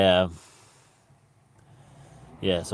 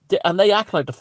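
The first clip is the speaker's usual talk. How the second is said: normal talking voice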